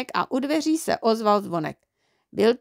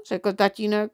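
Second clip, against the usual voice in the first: usual speaking voice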